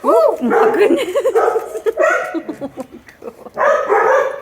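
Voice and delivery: delighted squeal. Real, not acted. Owner delighted as the dog gobbles her treat, high-pitched. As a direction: squeal with joy, stretching the last words.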